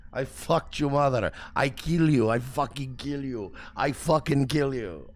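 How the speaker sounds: loud mock Turkish accent, broken English; mock Turkish troll. Reading angry Turkish messages aloud, mock Turkish accent. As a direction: read hate messages in a hammed-up Turkish accent. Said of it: "people calling me son of bitch".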